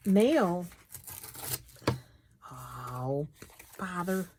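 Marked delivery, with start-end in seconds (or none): none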